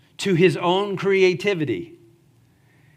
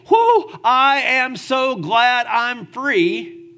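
louder, higher, drawn-out whoop of self-focused joy; mock celebration; 7 semitones higher, 5 dB louder, much slower than usual. Preacher mocks rejoicing in freedom to the church, slow hammed-up whoop. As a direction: shout it slowly like a party cheer. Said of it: "He doesn't say".

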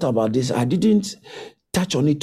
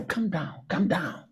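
soft soothing; 4 semitones lower, 9 dB quieter than usual. Shown as a demonstration. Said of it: "soothing the hearer".

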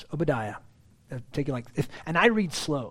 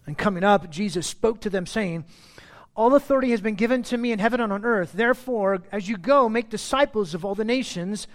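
raised, louder public reading of the verses; scripture reading; 6 semitones higher, 5 dB louder than usual. Acted. Pastor reading the Great Commission to the church, raised and proclaiming. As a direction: proclaim the text to the whole congregation.

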